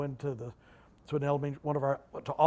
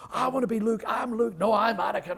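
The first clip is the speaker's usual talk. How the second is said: put-on excited kids; 7 semitones higher, 5 dB louder than usual. Little boys rushing up excited.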